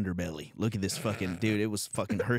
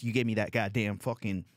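ordinary delivery

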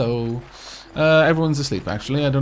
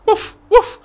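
high yappy woof; dog bark; 8 dB louder than usual. Acted. Little dog Scruffy barks at the player, high yappy woof. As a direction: yip like a small dog.

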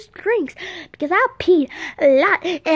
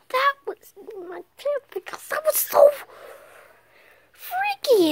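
rising squeal; 11 semitones higher than usual. Acted. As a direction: pitch climbs high into a squeal. Voice climbs in mock fright.

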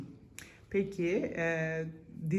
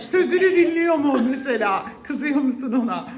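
laughing; 7 semitones higher, 11 dB louder than usual. Real, not acted. Teasing question about husband.

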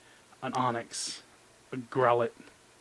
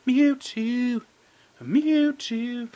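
pleading; 9 semitones higher, 5 dB louder, much slower than usual. Real, not acted. Begging the Mewtwo pack.

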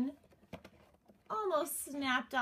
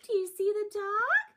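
ordinary talk, nothing marked

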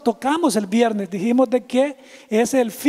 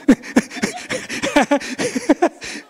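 laughing; 10 semitones higher than usual. Real, not acted. Laughs at joke about pupusa.

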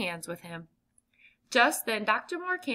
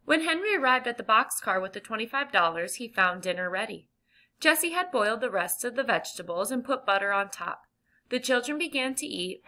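ordinary delivery, nothing marked

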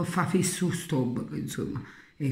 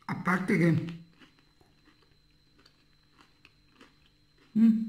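talking between bites; chewing while talking; 4 semitones higher, slower than usual. Real, not acted. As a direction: mouth partly full. Seen 2: Bites claw at 1.8, chews through 6.2 and after.

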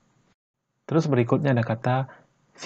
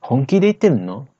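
louder, everyday question; natural speed; 3 semitones higher, 6 dB louder than usual. Shown as a demonstration. Teacher demonstrates the natural question. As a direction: ask it like you mean it. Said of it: "Kalau kita biasakan ngomongnya".

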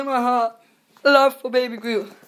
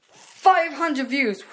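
drawn-out hype; 6 semitones higher, much slower than usual. Real, not acted. Hyping view count.

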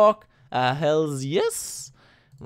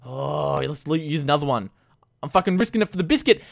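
normal talking voice

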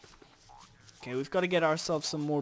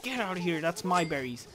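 normal talking voice

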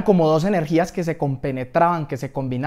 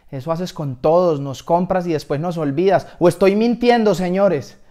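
loud rabble-rousing complaint, rising in pitch; rally the crowd against him; acted angry harangue; indignant accusation; 4 semitones higher, 7 dB louder than usual. Sock rallies the clothes against him.

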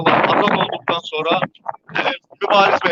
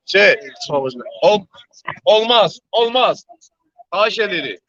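raised, higher voice to hush a crowd; calling out; 6 semitones higher than usual. Real, not acted. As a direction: call out over the crowd to stop. Calling crowd to stop chanting.

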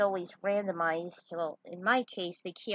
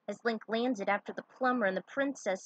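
plain reading voice

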